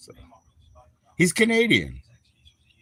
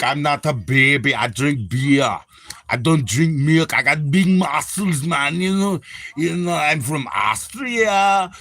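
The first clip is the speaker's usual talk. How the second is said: Arnold impression. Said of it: "make me act like Will Sasso".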